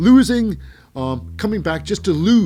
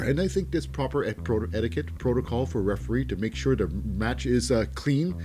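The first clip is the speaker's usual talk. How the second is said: normal talking voice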